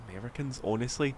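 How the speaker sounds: ordinary delivery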